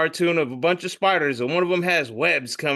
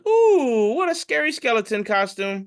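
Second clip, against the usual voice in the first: mock-impressed cooing; 6 semitones higher than usual. Toilet paper admiring a trick-or-treater, high mock-impressed coo. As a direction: coo as if at a child's costume.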